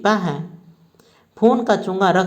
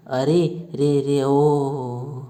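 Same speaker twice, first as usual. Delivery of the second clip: slow drawn-out groaning moan; loud groan; 5 semitones lower, much slower than usual. Acted. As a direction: groan out in pain, dragging it. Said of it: "तेजी से कराहकर बोलता है".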